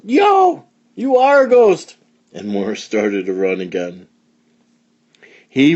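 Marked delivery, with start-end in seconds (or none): 0.0-2.0 very high, loud startled cry; suddenly believes the ghost; frightened yell. A narrator's reading. Morris yells in fright at Boris the ghost. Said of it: "Morris yelled".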